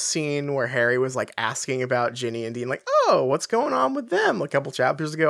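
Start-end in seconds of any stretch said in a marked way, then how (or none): 3.1-4.4 high-pitched imitation of a casual question; feigned nonchalance. Harry asking about Ginny and Dean, feigned nonchalance. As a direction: lightly mimic someone pretending not to care. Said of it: "very nonchalantly being like".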